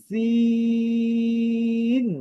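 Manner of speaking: সিন with a hissing s and long high vowel; pressure-cooker hiss. Shown as a demonstration. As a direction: sustain সিন on one high note after the hiss. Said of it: "pressure কুকারে একটি sound হয়". (প্রেসার কুকারের শিসের মতো সিন)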